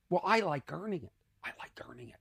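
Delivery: whispered, fading away; real whispered aside; whispered confession. Real, not acted. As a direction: confide it under the breath. Confiding he prefers earned laughs.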